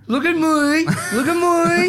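high whiny look-at-me voice; Kim impression. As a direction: imitate Kim from Kath and Kim. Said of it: "she does the same".

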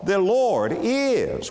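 high and slow, "is" stretched out and falling; stress on IS. Shown as a demonstration. Preacher dwelling on the little verb, stress on "is". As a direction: hold the word "is" long. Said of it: "put an emphasis on the word is".

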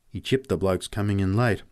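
usual speaking voice

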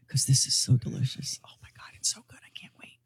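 gleeful unvoiced whisper; excited whisper. Real, not acted. Host whispering to co-hosts about the juicy story, gleeful. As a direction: whisper it like a delicious secret.

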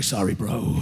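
low, casual bro reply; lazy bro voice. Retold or imitated. To his girlfriend, casual low bro apology. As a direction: toss it off like a surfer dude. Quoting himself, dumb apology.

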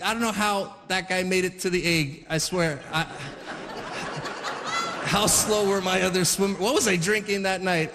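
ordinary talk

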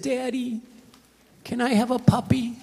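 put-on a three-year-old begging her daddy for a puppy; little girl pleading. Small daughter asking for puppy.

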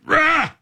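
high, sudden roar of effort; quick high-pitched heave cry; effortful "rah" yell. Retold or imitated. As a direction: let out a mock pushing yell. Retold grunt of pushing tire over.

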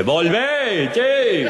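high calling yell from far off; high mock yell to come back. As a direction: call him back across the field. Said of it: "escucha el grito del instructor".

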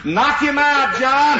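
high holler up the tree; calling up. Retold or imitated. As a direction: holler encouragement up to John. Yelling up the tree.

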